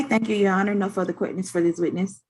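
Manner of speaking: normal talking voice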